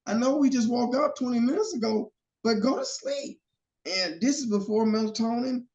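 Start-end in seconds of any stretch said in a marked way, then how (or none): 0.1-3.4 high, pleading, worn out; pleading tired dad. Retold or imitated. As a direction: beg the toddler to sleep. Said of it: "I was like".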